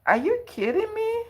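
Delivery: high, loud squeal of disbelief; can't believe the gift, pitch shoots way up; excited disbelief. Real, not acted. Stunned by a surprise donation.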